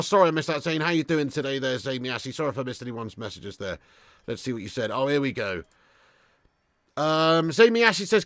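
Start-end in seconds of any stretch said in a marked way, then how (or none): none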